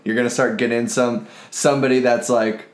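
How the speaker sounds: normal talking voice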